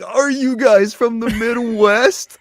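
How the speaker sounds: high, slow mocking question; imitating Lofty. Lofty teasing Minnesotans about their accent, high mock amazement. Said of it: "Lofty's like".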